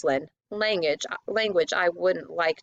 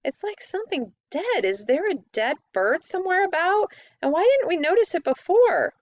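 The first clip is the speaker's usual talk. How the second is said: much higher, rising to loud alarm; disgusted alarm; 11 semitones higher, 3 dB louder than usual. A narrator's reading. Eustace smelling something dead, high and alarmed. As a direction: voice climbing in revulsion.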